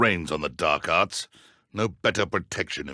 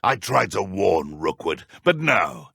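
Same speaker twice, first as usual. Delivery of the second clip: ordinary delivery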